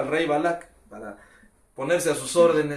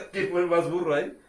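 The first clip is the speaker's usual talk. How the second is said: laughing; 2 semitones higher than usual. Real, not acted. Laughing at his own joke.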